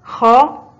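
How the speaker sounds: loud, clear announcement of خاء; loud teacher naming the letter خاء; letter announced. Shown as a demonstration. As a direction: call out خاء to the class. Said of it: "حرف الخاء محرك بحركة الفتح".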